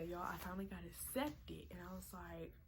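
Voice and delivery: ordinary delivery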